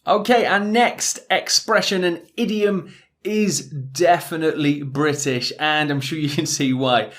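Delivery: ordinary talk, nothing marked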